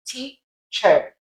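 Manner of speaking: British Teacher, split, ending "chuh"; Teacher without an R, British schwa; British accent. Shown as a demonstration. Teacher showing British against American, British accent. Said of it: "Whereas American".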